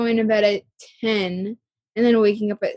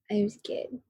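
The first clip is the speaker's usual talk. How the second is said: whispering; 11 dB quieter than usual. Real, not acted. Quiet aside about sad movie.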